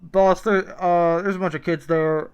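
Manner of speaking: nervous fast food employee talking to boss; drive thru speaker. Acted. Plays employee Michael.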